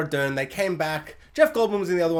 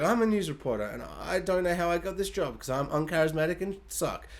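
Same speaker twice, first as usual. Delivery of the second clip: quiet, uninspired reporter drone; mock a dull reporter, voice kept soft; boring news reporter; 7 dB quieter than usual. Mocking the film's uncharismatic news reporter, boring and quiet. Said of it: "the most boring, uninspired, like, news report".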